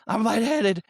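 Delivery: high, loud woozy whine; lightheaded puppet voice. Retold or imitated. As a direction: play it dizzy and faint, up high. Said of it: "He goes".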